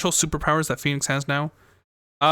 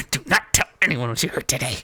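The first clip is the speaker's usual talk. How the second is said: scary voice; 4 dB louder than usual. Acted. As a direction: voice it like a creepy villain. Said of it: "That was a scary voice".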